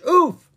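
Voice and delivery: short, high, loud oof; comic grunt on a hard landing; impact oof. A narrator's reading. Bouncing down a hill.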